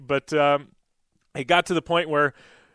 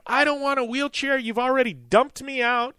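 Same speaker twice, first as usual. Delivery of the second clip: retold scream; 8 semitones higher than usual. Passenger refusing a wheelchair, mock high screech. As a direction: mimic a shrill passenger yelling at the crew. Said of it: "she starts screaming at him".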